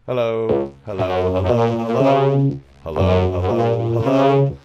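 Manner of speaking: hello through a vocoder, on held notes; robotic, stepped pitch on each hello; vocoder test. Shown as a demonstration.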